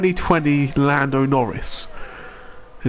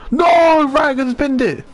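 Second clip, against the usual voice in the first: shocked shout; 10 semitones higher than usual. Real, not acted. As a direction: yell in shock at the crash. Another car crashes, live commentary.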